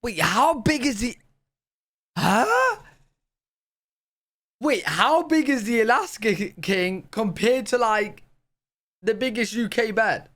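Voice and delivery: high-pitched real disbelief; incredulous, high questioning; baffled surprise. Real, not acted. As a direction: blurt it out in astonishment, voice shooting up. Baffled reaction to huge mattress size.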